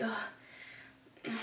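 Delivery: voiceless annoyed grunt; mock exasperated sigh; frustrated ugh. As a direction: breathe out an irritated ugh. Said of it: "she's like".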